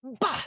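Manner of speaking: high, sudden punch sound; imitated punch noise. Fighter stands up and jabs, imitated punch noise.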